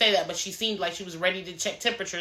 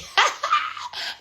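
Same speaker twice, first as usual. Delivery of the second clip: very high, breaking cackle; amused laugh. Real, not acted. Reacting to the crazy part of the episode, high cackling laugh. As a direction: burst out in high-pitched laughter.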